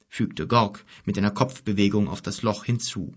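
ordinary delivery

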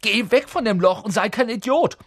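high, loud, exasperated yell; shouted order. A narrator's reading. Ron ordering Neville away from the hole, shouting. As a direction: yell at him to move. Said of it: "schrie Ron auf".

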